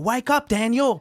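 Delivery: high-pitched acted wake-up call; mock calling out. Mia waking Daniel as the spirit attacks, high mock call. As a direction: call out high in a put-on character voice.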